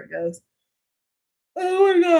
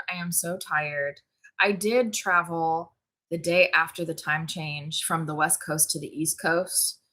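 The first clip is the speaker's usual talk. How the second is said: ordinary talk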